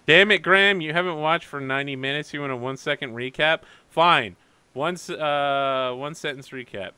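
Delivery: loud, exasperated outburst. Acted. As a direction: scold him in mock frustration. GM mock-exasperated at late viewer.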